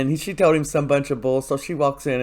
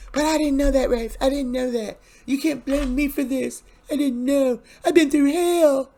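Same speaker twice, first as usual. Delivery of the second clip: much higher, whining plea, pitched up and a bit louder; whiny Jada voice; 13 semitones higher, 3 dB louder than usual. Retold or imitated. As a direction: play the desperate girlfriend begging in a high whine. Jada pleading with Rafe.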